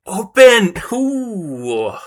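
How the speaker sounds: Open! blurted high and loud; surprised realization. Real, not acted. Learner suddenly recognizes the English word, loud surprised open.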